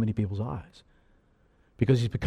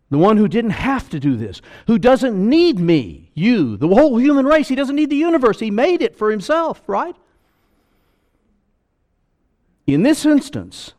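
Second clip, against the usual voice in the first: preaching at full force; 10 semitones higher, 8 dB louder than usual. Acted. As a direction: build it up, climbing higher and louder. Preacher builds to a peak.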